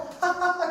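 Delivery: a light amused laugh; mock laugh. Preacher mimicking a lucky improviser, laughing. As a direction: laugh like it came out by chance.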